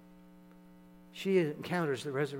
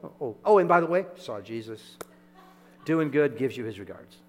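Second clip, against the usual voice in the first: mock offhand; 9 dB louder than usual. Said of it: "hard for me to believe that she didn't say".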